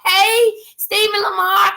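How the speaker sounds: high, loud and slow, stretched toward a viewer; a sing-song shout-out to a friend watching; called-out greeting. Real, not acted. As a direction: call the name out high and drawn-out. Calling out a viewer's name.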